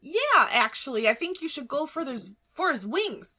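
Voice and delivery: talking dagger offering tips in a lifted voice; Gemini. Acted. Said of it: "Gemini thinks for a second, and he says".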